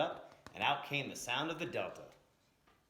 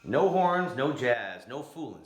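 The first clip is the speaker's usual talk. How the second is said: defiant shout; 2 semitones higher, 11 dB louder, slower than usual. Acted. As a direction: shout it down, no deal. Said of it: "said Muddy".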